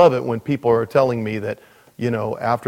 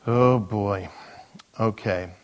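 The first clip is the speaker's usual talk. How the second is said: ordinary delivery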